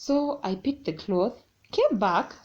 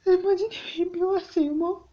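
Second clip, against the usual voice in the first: scared confession; 11 semitones higher than usual. Retold or imitated. Telling mom the phone was stolen.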